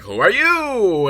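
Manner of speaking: high, drawn-out mock demand; slow, swooping retold challenge; mock monk challenge. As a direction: stretch it into a hammed-up, high call.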